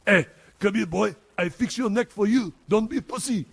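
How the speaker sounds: put-on high, loud French-accented old veteran. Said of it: "One more time with that".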